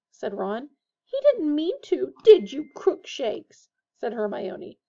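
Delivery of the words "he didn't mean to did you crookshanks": higher, louder, slow coo to the cat; baby the cat lovingly. A narrator's reading. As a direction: Hermione cooing to her cat. Cooing to the cat.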